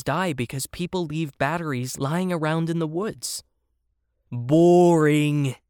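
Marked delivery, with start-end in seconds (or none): 4.3-5.6 loud, drawn-out dismissal; bored kangaroo. A narrator's reading. Kangaroo dismisses the hedgehog's lecture, loudly bored. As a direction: stretch the word out in loud disdain. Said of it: "rolling his eyes".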